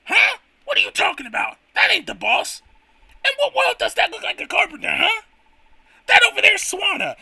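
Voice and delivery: high squeaky indignant worker; high-pitched Toad-style sidekick. Acted. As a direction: squeak it out, outraged. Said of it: "it's either Toad or nothing".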